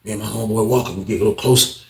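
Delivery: usual speaking voice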